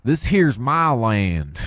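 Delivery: mock patriot. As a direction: drawl it like an angry patriot.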